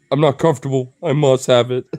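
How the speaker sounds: loud, indignant voice for the dog; mock mad. Voicing the dog without its ball, mock mad. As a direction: play a put-out pet, loudly. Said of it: "He's all mad. He's like".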